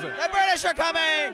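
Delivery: hammed-up British accent, high and loud; mock British. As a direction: announce it like a mock town crier. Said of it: "a little bit of British".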